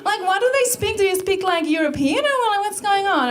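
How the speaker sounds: high, clueless gushing questions; hammed-up excitable American curiosity; Amber. Amber quizzing the Icelandic tourist, high-pitched and excited. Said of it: "freaks out".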